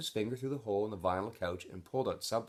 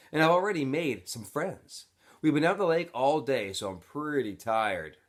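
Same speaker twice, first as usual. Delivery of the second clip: cheerful letter; 6 semitones higher, 5 dB louder than usual. Acted. Stanley reading his letter aloud.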